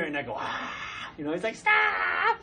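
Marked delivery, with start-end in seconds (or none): 0.4-1.1 long open-mouthed exhale; voiceless breath blast. Retold or imitated. Dad breathes on his child, teasing open-mouthed exhale. 1.5-2.4 high, loud, drawn-out protest; mock child shout. Child recoils from dad's breath, high mock shout.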